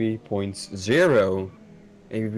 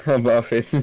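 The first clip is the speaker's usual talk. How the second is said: laughing; 5 semitones higher, 3 dB louder than usual. Real, not acted. Laughs about his Dutch accent.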